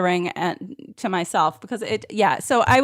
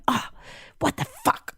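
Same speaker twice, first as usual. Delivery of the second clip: squeaky high, hushed disbelief; exasperated mutter; 8 dB quieter than usual. Retold or imitated. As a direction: mutter it to yourself in shock. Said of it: "I'm going".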